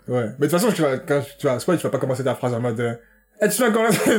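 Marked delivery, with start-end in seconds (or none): 3.4-4.2 mock exclamation. Mock spoiler opener in podcast.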